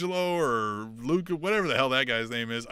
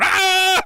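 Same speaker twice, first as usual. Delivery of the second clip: loud, high imitated scream; mock scream; 10 dB louder than usual. Imitating wrestlers screaming in his ear, mock scream. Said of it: "fucking screaming in his ear".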